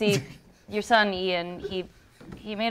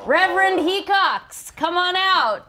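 higher, drawn-out host introduction; announcer call-out; 9 semitones higher, slower than usual. Acted. Host announcing daughter's father to the show. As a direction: present him like a game show host.

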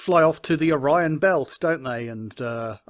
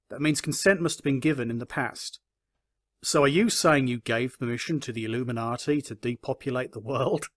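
ordinary talk, nothing marked

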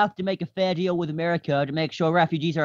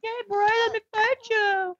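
voiced iron golem. Player voices golem itching to fight, high put-on voice. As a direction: play an eager golem in a high voice. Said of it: "The Iron Golem's like".

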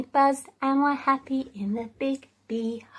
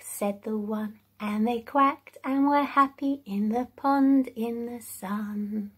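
usual speaking voice

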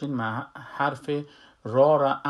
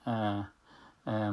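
usual speaking voice

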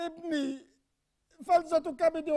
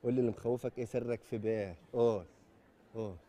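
coaxing softly; 3 dB quieter, faster than usual. Acted.

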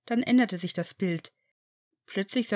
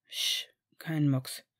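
slow whisper, much softer; hushed murmur; 5 semitones lower, 5 dB quieter, much slower than usual. A narrator's reading. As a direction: hush them slowly in hiding. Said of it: "raunte er".

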